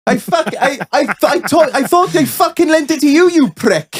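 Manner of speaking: put-on high, sing-song Welsh accent, indignant. Welsh workman accusing his mate over a lent tool, Welsh accent. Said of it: "I'll do my best Welsh accent".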